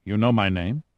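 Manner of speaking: ordinary delivery, nothing marked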